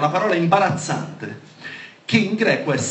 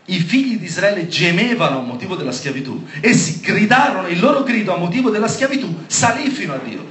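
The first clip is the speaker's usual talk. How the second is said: raised, higher preaching voice; emphatic scripture proclamation; 5 semitones higher, 5 dB louder than usual. Real, not acted. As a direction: drive each phrase of the verse home. (lettura biblica predicata con forza)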